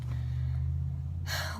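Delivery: long breathy sigh; re-enacted parental sigh; exasperated sigh. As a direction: sigh long like a resigned mom. Mom's reaction in retold scene.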